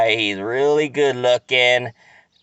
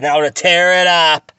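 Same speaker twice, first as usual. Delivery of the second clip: mock taunt; 5 semitones higher, 5 dB louder than usual. Mocking taunt at the husband.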